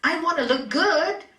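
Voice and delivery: a hint of a vain show-off; mock ego voice. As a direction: play the vain ego, puffed up. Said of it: "That's ego speaking".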